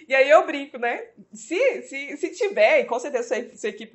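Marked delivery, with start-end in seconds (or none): none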